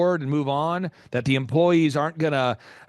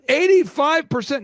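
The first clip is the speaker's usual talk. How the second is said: incredulous; 11 semitones higher, 5 dB louder than usual. Real, not acted. Repeats the shocking stat.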